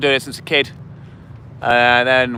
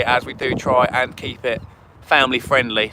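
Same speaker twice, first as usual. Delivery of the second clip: usual speaking voice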